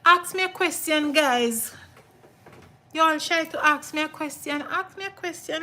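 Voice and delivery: rally the chat to ask questions; excited host calling to her live viewers. Real, not acted.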